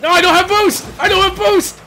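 high, urgent protest; panicked cry. Real, not acted. Caught in play without boost.

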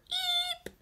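very high tiny eep; high mouse-like eep; startled squeak. Acted. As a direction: squeak in fright.

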